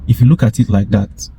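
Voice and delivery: ordinary delivery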